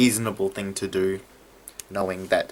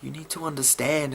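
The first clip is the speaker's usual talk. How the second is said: much higher, desperate pleading cries; frantic pleading; 9 semitones higher than usual. Retold or imitated. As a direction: beg them to leave her alone. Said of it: "and it's like".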